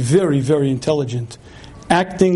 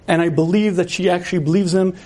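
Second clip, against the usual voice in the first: usual speaking voice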